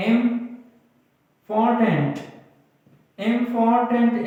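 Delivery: "Important" slowly split, pausing after इं; syllable by syllable. Shown as a demonstration. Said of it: "ये कितने syllable से बना है?". (इंपॉर्टेंट को धीरे-धीरे तोड़कर)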